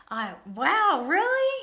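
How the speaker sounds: hammed-up amazement for a child; mock astonishment at son's news; exaggerated surprise. Feigning surprise for son.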